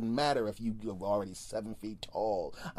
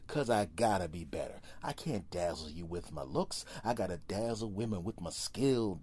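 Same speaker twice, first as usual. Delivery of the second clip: ordinary talk, nothing marked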